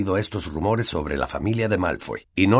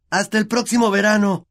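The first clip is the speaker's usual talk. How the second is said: much higher triumphant call; Harry shouting back at his relatives; 13 semitones higher, 3 dB louder than usual. Acted. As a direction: yell back cheekily while escaping. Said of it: "gritó Harry".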